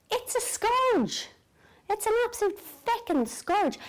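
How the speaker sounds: high-pitched, drawn-out lament; exasperated. Real, not acted. Insomniac venting to viewers about sleeplessness, exasperated. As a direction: wail it in comic exasperation, stretching SCOURGE.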